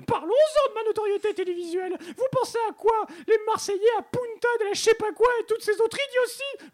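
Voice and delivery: loud, very high-pitched Marseille outrage; Marseillais accent. Acted. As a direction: rant in a shrieky Marseille accent. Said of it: "je reçois l'accent marseillais". (accent marseillais suraigu, outré)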